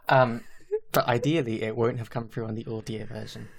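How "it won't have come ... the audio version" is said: usual speaking voice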